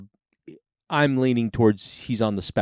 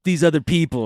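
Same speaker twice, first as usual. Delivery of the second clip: higher, louder booming God voice; 7 semitones higher, 5 dB louder than usual. Retold or imitated. Recounting how God told him to fight, mock divine voice. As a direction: play God handing down a command. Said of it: "God's like".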